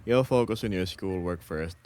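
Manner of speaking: ordinary talk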